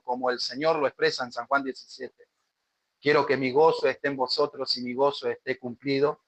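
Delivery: prayer. Acted. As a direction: pray humbly before God. Pastor leads congregation in prayer.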